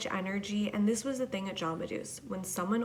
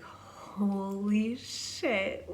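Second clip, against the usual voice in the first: slow, drawn-out stoned awe; much slower than usual. Retold or imitated. Stoned worker walking back into the shop, slow dazed awe. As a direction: let it hang, dazed and amazed.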